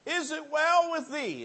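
high, loud preached question; preaching call. Acted. Preacher calling the question to the congregation. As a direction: ring out the question.

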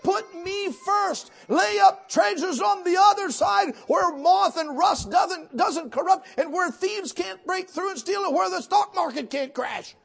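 a full-throated revival preacher; preaching at full force. Acted. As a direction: proclaim it to the whole church. Quotes God at full preaching force.

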